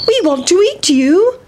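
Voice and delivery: put-on high, eager children's voice; sing-song high storybook boys; hungry little boys. Hungry boys chase the pancake.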